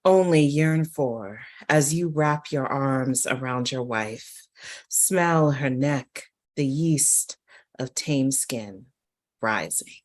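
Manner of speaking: slow, weighty poem reading with a loud swell; poetry reading. Acted. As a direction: read it like a spoken-word poem. Poet reads her poem aloud.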